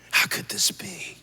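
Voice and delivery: a broken whispered plea; anguished whisper. Retold or imitated. Preacher recalling his daughter's diagnosis, whispered anguish. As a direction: breathe it out, barely voiced. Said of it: "I just began to yell".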